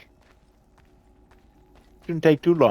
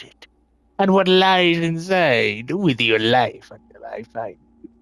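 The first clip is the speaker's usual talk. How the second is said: put-on pirate accent. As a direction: read the captain's letter like a theatrical old pirate. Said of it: "We get to put on my pirate accent again".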